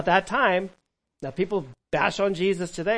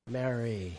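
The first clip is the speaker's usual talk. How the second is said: lower, soft and loving Mary; 5 semitones lower, 5 dB quieter than usual. Shown as a demonstration. Jesus calling to Mary at the tomb, loving. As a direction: call her name tenderly, like an old friend. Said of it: "this loving voice".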